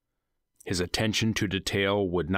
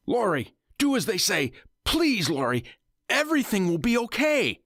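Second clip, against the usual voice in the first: desperate pleading; 13 semitones higher than usual. Acted. Husband begging his wife to obey the captors, frantic. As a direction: beg her to obey, panicked.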